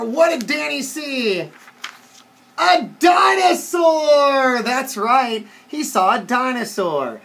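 big, slow storybook reveal; reading to children. A narrator's reading. Reader reveals what Danny saw, slow excited storytelling. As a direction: stretch the key words with excited wonder.

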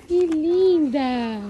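pet talk. Real, not acted. Rider gushing over the mare Virconita, high slow coo. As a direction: drag the words out, adoring the horse. Cooing to a horse.

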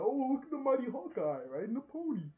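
high, loud jeering thug voice; mocking thug. Tracksuit mafia mocking Hawkeye, high jeering voice. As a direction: jeer at him like a gleeful goon.

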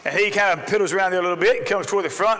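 normal talking voice

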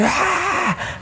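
short high-pitched mock scream; high scream at no great volume; imitated scream. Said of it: "I hear this terrifying scream".

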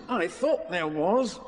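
dozy, unhurried character voice; unbothered Pooh. Acted. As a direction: answer placidly, taking your time. Pooh answers slow and unbothered.

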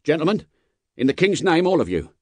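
high, urgent appeal, dropping at the end; hurried adjuration. A narrator's reading. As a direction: appeal to the passengers in haste. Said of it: "With this hurried adjuration".

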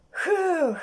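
high, drawn-out "huh" of exhaustion; tired puffing. Acted. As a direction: let out a weary, voiced puff. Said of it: "як робив Том". (втомлене «хух»)

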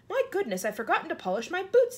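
high-pitched storybook dismay; mock-horrified, high and animated; alarmed exclamation. A narrator's reading. Centipede realises he forgot his boots.

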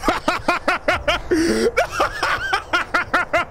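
high burst of laughter. Real, not acted. Laughs at ending.

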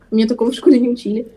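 ordinary talk, nothing marked

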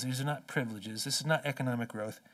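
ordinary talk

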